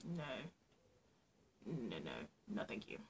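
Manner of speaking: normal talking voice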